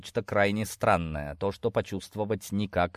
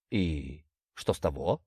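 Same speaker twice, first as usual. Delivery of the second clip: low, slow, unimpressed father; frowning father; 5 semitones lower, 5 dB quieter, slower than usual. A narrator's reading. Father dismissing daughter's worry, gruffly unimpressed. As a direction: challenge it slowly in a low voice. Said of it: "нахмурился отец семейства".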